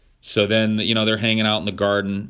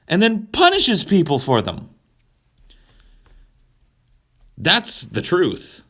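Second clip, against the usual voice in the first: louder and much higher, worked-up tirade; heated rant; 7 semitones higher, 4 dB louder than usual. Real, not acted. Speaker ranting to viewers that God is sadistic, heated and loud. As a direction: pound the point home.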